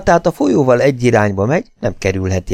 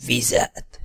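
parched, voiceless whisper; rasping whisper; 3 semitones higher, 7 dB quieter than usual. A narrator's reading. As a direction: gasp it out in a whisper. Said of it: "hörögve suttogta". (hörgő suttogás)

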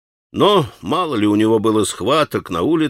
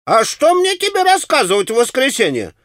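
put-on much higher, scolding the boy; a market woman raising a fuss; shouting; 8 semitones higher than usual. A narrator's reading. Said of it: "раскричалась Марья".

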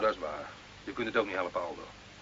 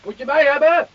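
raised voice, high; calling back; 8 semitones higher, 5 dB louder than usual. Acted. Carlo shouts back to the officers from afar, calling. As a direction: call back from down the road.

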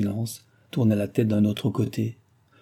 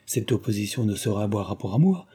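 ordinary delivery, nothing marked

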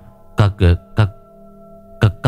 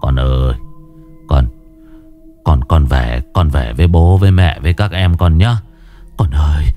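mumbled grief; 7 semitones lower than usual. Acted. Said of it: "mà lẩm bẩm".

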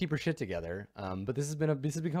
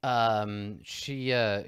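ordinary talk, nothing marked

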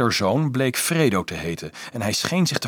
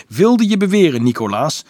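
loud, high indignant challenge; angry; 8 semitones higher, 8 dB louder than usual. A narrator's reading. Dolf challenging Nicolaas over the game, angry. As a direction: confront him crossly. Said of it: "zei Dolf kwaad".

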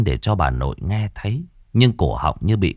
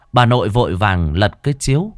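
ordinary delivery

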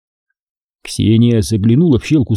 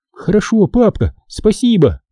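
higher, squealing with joy; happy squeal; 9 semitones higher than usual. Acted. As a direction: squeal thank you like a thrilled girl. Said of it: "радостно взвизгнула девчонка".

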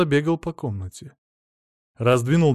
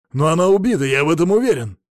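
loud, raised protest; insistent; 4 semitones higher, 6 dB louder, faster than usual. A narrator's reading. Husband insisting wife is murdered, loud and insistent. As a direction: insist it forcefully.

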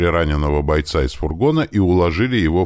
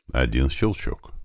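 ordinary delivery, nothing marked

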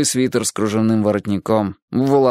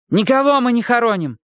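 defiant denial; 13 semitones higher than usual. A narrator's reading. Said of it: "girl denies angrily".

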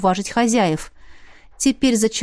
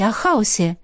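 usual speaking voice

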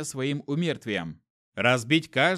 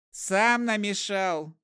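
much higher, slow, moaning admission; a sick trader confessing; groaning; 6 semitones higher, much slower than usual. A narrator's reading. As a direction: moan it while clutching the head. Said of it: "простонал он".

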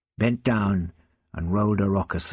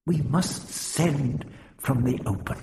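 grim; 7 semitones higher than usual. A narrator's reading. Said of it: "he muttered grimly".

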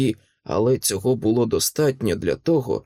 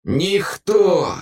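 high, forceful monster retort; lightly voiced snarling creature; growling nightmare; 7 semitones higher, 4 dB louder than usual. A narrator's reading. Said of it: "прогарчав кошмар".

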